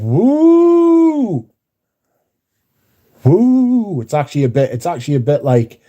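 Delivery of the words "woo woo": high whooping wind noise; imitated wind howl. Streamer to chat, imitating the wind with a high whoop. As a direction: mimic the wind howling through the window. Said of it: "It's literally going".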